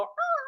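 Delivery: very high mock "ahh" of alarm; high recoiling squeal. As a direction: squeal as if backing away. Imitates prospects recoiling from big vision.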